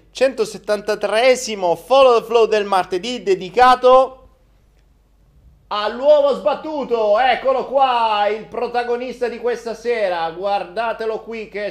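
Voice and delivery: high, loud presenter voice; excited host intro. Real, not acted. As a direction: present the star dish with fanfare. Show intro continues.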